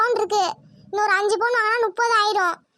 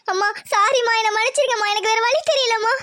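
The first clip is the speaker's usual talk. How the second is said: ordinary talk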